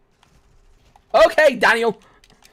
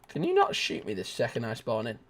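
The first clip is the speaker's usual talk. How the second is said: ordinary talk, nothing marked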